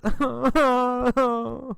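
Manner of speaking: high-pitched acted wail; hammed-up sobbing wail; mock crying. A narrator's reading. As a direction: cry out like a hurt girl in a story. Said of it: "The girl cries".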